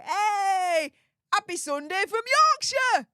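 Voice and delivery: high, whooping regional shout-out; excited cheer. Real, not acted. Streamer returns a viewer's Sunday greeting, excited cheer. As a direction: call it out high and jubilant.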